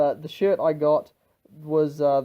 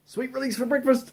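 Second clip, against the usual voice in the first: excited cheer; 10 semitones higher, 3 dB louder than usual. Real, not acted. Excited cheer about breakfast spot.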